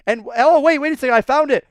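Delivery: high, loud wait, wait; sudden discovery. Real, not acted. To viewers, spotting a far-behind swimmer on the slide, high and loud. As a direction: gasp out a discovery.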